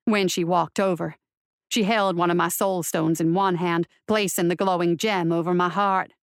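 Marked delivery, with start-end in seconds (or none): none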